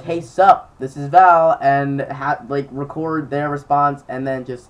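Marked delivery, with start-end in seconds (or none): 0.0-1.6 louder, breezy 'hey, sup'; casual loud hello. Pretend. Calling old contacts on webcam, breezy and louder. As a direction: toss it off like nothing changed. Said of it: "just be like".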